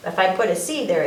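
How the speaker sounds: usual speaking voice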